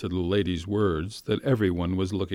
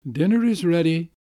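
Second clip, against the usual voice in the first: servant calling; 9 semitones higher, 6 dB louder than usual. A narrator's reading. Servant calls Mashenka to dinner.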